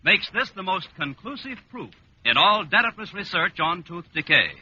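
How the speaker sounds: ordinary talk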